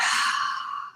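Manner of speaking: full, airy sigh out through the mouth; long exhaled sigh. Shown as a demonstration. Teacher showing listeners the deep out-breath, sighing fully. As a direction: let all the air go in one soft, voiceless sigh. Demonstrates the breath she instructs.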